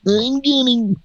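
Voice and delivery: very high squeaky voice; high falsetto. Acted. Comic falsetto bit.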